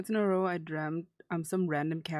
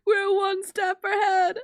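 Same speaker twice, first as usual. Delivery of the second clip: slow, high, loud joyful cry; excited; 13 semitones higher, 6 dB louder than usual. Real, not acted. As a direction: call it out near tears. Package shipped.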